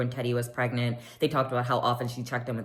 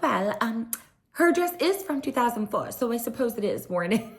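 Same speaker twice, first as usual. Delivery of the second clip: higher, prim voice delivering a dig; mock posh shade; 8 semitones higher than usual. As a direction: deliver the insult sweetly. Imitating Dorit's shady confessional.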